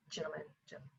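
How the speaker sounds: whispered, conspiratorial; hushed aside. Real, not acted. Warning the men, hushed whisper. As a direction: lean in and whisper.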